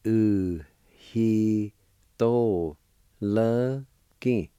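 "U, hi, to, le, ki" each syllable on its own, with clear pauses between; Cherokee syllables. Shown as a demonstration. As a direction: build "Uhitoloki" up one syllable at a time. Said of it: "It's pronounced like this".

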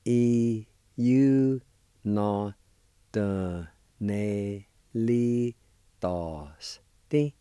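E U Notonelitos Ti sounded out very slowly; teacher sounding out E U Notonelitos Ti; syllable-by-syllable Cherokee. Shown as a demonstration. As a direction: break the word into syllables for the class. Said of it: "it's pronounced like this".

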